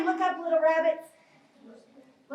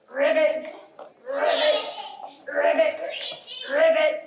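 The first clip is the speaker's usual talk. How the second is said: frog ribbit. Pretend. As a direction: pretend frog calls, popped out one at a time. More jumping frog ribbits.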